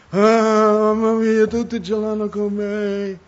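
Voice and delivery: high, loud, slow self-pitying moan; a believer moaning that everyone is against him; mock whining complaint. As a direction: ham up a sorry-for-myself wail.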